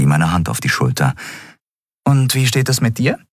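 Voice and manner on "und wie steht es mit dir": higher, lightly voiced woman; a kind question to the boy; captain Endriel. A narrator's reading. Endriel asks Miko. (leicht gehobene Frauenstimme)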